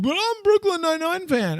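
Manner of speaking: hammed-up whiny Brooklyn Nine-Nine fan. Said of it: "people are gonna be like".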